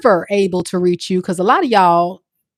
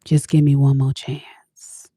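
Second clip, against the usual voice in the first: slow breathy whisper; whispered plea; 4 semitones lower, slower than usual. Acted. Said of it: "they gotta get their voice right".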